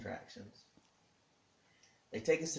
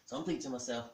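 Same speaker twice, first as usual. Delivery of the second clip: ordinary talk, nothing marked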